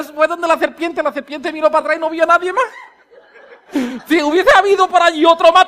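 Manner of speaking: normal talking voice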